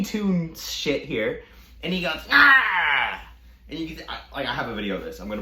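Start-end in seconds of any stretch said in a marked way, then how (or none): 2.3-3.2 loud, high imitated battle cry; mock attack yell.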